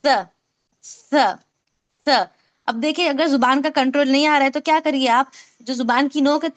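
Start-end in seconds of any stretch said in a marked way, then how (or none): 0.0-2.3 स as th in "think"; lisped स, isolated and repeated; tongue-out स. Shown as a demonstration. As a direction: say स with the tongue tip just past the upper teeth. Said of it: "थोड़ी सी जुबान इसमें बाहर रहेंगी".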